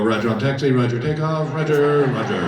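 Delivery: low mock pilot radio talk. Passenger who couldn't shut up, in low pilot talk. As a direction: repeat "Roger" low like a would-be pilot. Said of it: "if I don't talk like a pilot".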